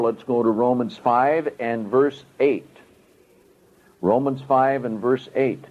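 ordinary delivery

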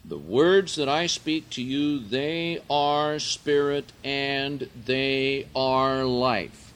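loud, high, slow preaching; full-force preaching. Acted. Preacher to the brethren, loud high preaching. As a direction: drive the words home to the brethren.

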